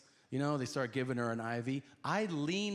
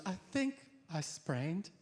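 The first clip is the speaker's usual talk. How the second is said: lightly voiced wife; 7 semitones higher than usual. Injured wife voiced in story.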